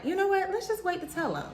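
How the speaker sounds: high-pitched quote of herself; lifted, light voice for a retold suggestion; coaxing suggestion. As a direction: pitch it way up, like floating a cautious idea. Quoting herself suggesting they wait.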